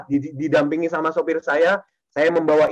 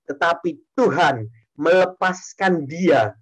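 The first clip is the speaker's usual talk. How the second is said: scripture reading; 3 semitones lower, slower than usual. Shown as a demonstration. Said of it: "Sekali lagi saya baca".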